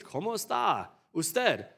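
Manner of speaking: "¿Cómo está usted?" said slowly in Spanish; Spanish sample phrase. Shown as a demonstration. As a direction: spell out "¿Cómo está usted?" slowly, as a foreign-language example. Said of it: "only certain languages".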